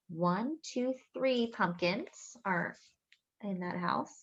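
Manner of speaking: usual speaking voice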